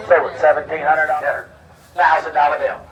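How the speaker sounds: ordinary talk, nothing marked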